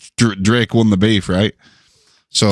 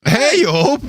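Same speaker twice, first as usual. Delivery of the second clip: squeaky high greeting; high-pitched voice. Pretend.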